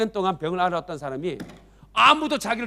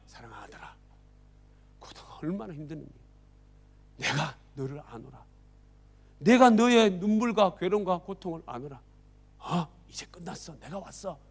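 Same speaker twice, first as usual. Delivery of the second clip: softer, gentle, consoling; tender comfort; 3 semitones lower, 6 dB quieter than usual. Acted. Jesus comforting the long-sick man, soft and tender. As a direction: speak softly to a weary man.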